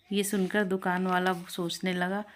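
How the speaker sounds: ordinary delivery, nothing marked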